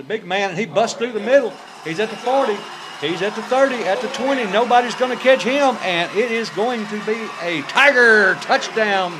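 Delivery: building to a loud excited shout; touchdown call. Real, not acted. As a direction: yell it as he breaks free.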